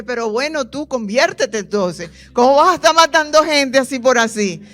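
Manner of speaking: a wife scolds the surgeon who nearly killed her husband, loud and tearful; tearful scolding. Retold or imitated. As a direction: tell the doctor off loudly, close to crying. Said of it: "Y yo lloré y le dije".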